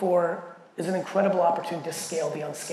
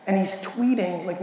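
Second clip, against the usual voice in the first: ordinary delivery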